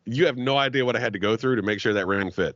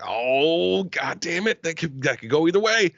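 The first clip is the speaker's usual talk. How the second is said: nervous doubt; 7 semitones higher than usual. Retold or imitated. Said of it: "I'm just sitting there like".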